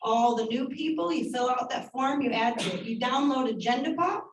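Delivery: usual speaking voice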